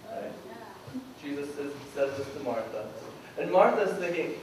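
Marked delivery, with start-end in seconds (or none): none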